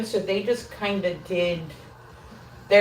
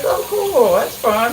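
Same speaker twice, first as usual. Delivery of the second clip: mock excited; 3 semitones higher, 6 dB louder, slower than usual. Retelling her reaction to the skeleton light with mock delight. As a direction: gush like a delighted kid. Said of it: "I was like".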